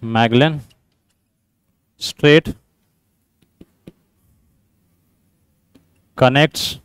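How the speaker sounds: low, soft, word-by-word dictation; slow dictation. Real, not acted. Teacher reading out a quiz statement for students, slow dictation. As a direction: dictate slowly with long gaps between words.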